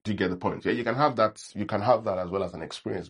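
ordinary talk

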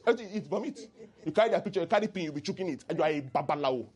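usual speaking voice

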